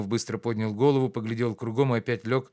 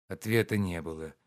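ordinary delivery

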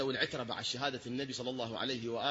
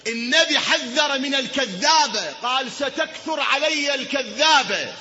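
high, loud, drawn-out pulpit cry; fervent preaching; 10 semitones higher, 10 dB louder, much slower than usual. Acted. Preacher warning the congregation about heresy, fervent shout.

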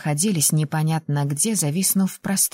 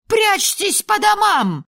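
much higher, slow panicked yell; alarm cry; 14 semitones higher, 4 dB louder, slower than usual. A narrator's reading. Neighbour yells to the street to hide at home. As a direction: warn everyone to get indoors. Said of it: "раздался крик на всю улицу".

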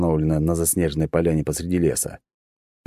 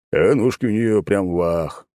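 leering soldier; 3 semitones higher, slower than usual. Acted. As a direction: savor it like a lout. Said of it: "подметил другой боец". (медленный похабный голос бойца)

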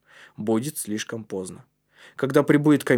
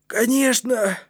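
put-on high, strained boy's voice; eager agreement; 11 semitones higher, slower than usual. A narrator's reading. Кнут assures his friends he will make it, eager. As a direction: agree keenly though worn out.

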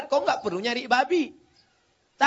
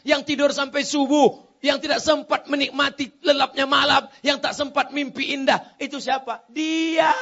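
loud, raised-pitch rhetorical build; impassioned preaching; 4 semitones higher, 5 dB louder than usual. Real, not acted. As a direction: hammer out each phrase to the crowd, high and forceful. Preacher's rousing list of wife's sacrifices. (ceramah lantang berapi-api)

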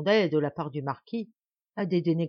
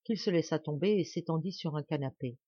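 ordinary delivery

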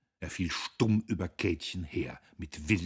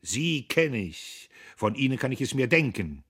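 angry outburst; 6 semitones higher, 8 dB louder than usual. A narrator's reading. Guste turns on Diederich, angry outburst. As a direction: burst out with the accusation. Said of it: "Guste brach los".

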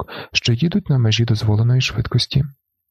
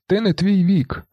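high-pitched cry at everyday volume; understated yell; 8 semitones higher than usual. A narrator's reading. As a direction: call out the slogan in a raised pitch. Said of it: "механік волає".

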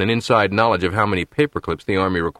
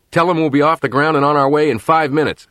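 alert and brisk; 6 semitones higher, 4 dB louder than usual. Acted. Rescue officer now fully alert.

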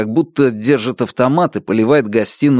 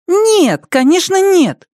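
put-on child voice; 4 dB louder, slower than usual. Boy denies.

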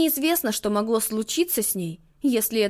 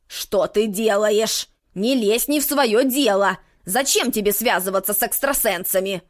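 stern scolding witch; 4 semitones lower, 3 dB louder than usual. Acted.

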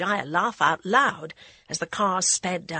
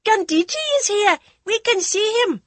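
put-on high, loud child voices; children's excited shouts; 8 dB louder than usual. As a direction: shout from up in the trees. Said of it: "excited shouts of the children".

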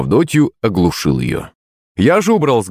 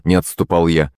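usual speaking voice

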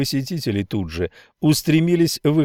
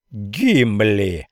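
yelled name. Acted. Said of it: "завопил Миллер".

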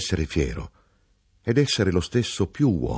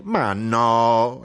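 a sharp, raised "Ma no" of protest; exclaiming; 4 semitones higher than usual. A narrator's reading. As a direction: burst out in disagreement. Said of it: "esclamò mia madre". (esclamando con slancio)

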